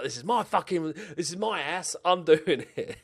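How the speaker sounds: lightly mock Gusto's accent, defiant; Gusto accent attempt. Gamer imitating his director of football Gusto, failed accent. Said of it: "I can't do a Gusto accent".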